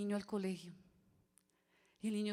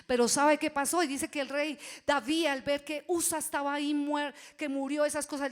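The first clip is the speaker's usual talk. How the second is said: much higher and louder, building; fervent preaching; 6 semitones higher, 8 dB louder than usual. Real, not acted. Preacher shouting the story of King David. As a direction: preach it out full force.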